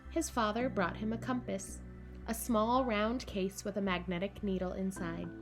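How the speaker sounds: plain reading voice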